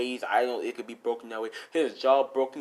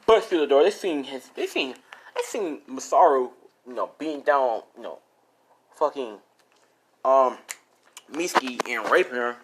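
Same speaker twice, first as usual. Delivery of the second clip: ordinary delivery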